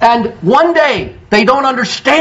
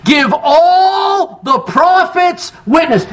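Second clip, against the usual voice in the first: slow cried-out preaching; 6 semitones higher, slower than usual. Acted. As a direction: cry it out to the congregation. Preacher cries out scripture.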